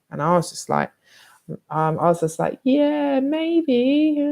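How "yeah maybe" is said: coy brush-off. Retold or imitated. Brushing off a boy's advances.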